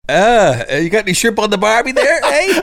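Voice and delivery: put-on high, fast Australian accent, laughing through it; mock Australian accent. Teasing his Australian cohost in a laughing, high mock Australian accent. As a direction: tease him with a silly Australian accent.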